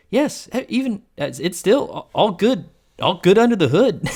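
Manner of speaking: high, flustered stammering Greg. Retold or imitated. Greg cornered by Jack in the car, high and flustered. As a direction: scramble nervously for an answer. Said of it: "And then he goes".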